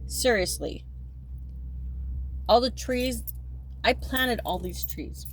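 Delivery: ordinary talk, nothing marked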